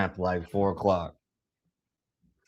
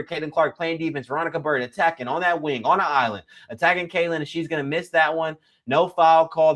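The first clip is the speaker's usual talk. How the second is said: live play-by-play; 4 semitones higher than usual. Acted. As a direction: call the action as it happens, pushing the energy.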